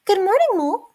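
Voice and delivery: Good morning, Mole in a high squeak; high squeaky mouse greeting. Shown as a demonstration. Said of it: "let me try that voice again".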